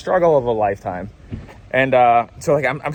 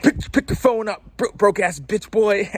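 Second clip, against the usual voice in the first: slow, sneering put-on voice of the thief, climbing higher; mocking the thief; 8 semitones higher, slower than usual. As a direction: sneer the insults like the rude stranger. Voicing the thief taunting again.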